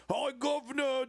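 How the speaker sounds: high, hammed-up fake British accent, American style; cringy fake British accent. Imitating a YouTuber's cringy fake British greeting. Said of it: "incredibly cringy American top of the morning, like, British accent".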